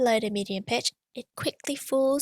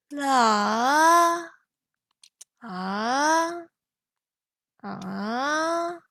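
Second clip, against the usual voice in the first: quicker dip and rise; same tone, tighter; quicker third tone; 2 semitones higher, much slower than usual. Shown as a demonstration. Teacher doing the third tone faster now. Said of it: "do it a bit quicker now".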